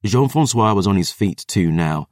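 plain reading voice

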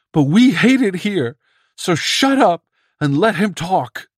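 high, loud screaming; screamed. Acted. Swope screams at Bedzik to let Curran talk, high loud scream. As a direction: scream him into silence. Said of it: "and he screamed".

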